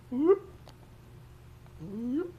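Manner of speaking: sudden high yelps. Real, not acted. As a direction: yelp in surprise. Falling in game.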